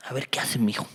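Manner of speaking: low, hushed whisper; watchful father's aside. Acted. Father secretly watching his son's choice, whispered. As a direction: think it quietly while secretly watching.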